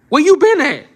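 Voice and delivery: high, loud, disbelieving call-out; mocking high-pitched outburst; incredulous shout. Real, not acted. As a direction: yell it like he missed the obvious. Incredulous retort to the doctor.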